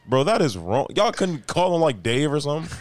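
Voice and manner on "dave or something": ordinary talk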